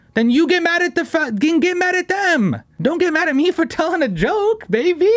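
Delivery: exasperated high-pitched outburst; shrill mock retort. Real, not acted. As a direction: yelp it back at the critics, way up high. Mock retort to critics, voice cranked up.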